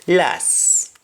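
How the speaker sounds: "las" with a silent final T; T dropped. Shown as a demonstration. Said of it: "You simply say".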